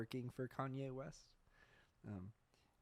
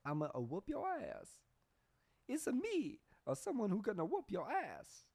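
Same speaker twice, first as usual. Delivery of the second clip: high, loud, badly faked Italian plumber accent; Super Mario; 11 semitones higher, 4 dB louder than usual. Comic retrying a threat after botching it, horrible Mario impression. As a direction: squeal a silly threat, climbing much higher midway. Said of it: "I can't even do a Mario accent".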